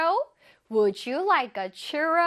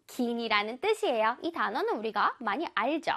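normal talking voice

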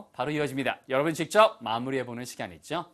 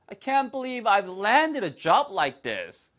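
high, slow, marveling delivery; amazed disbelief; 6 semitones higher, 4 dB louder, much slower than usual. Acted. Teacher models landing a job, amazed. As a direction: stretch it out in happy amazement. Full emotional model of the sentence.

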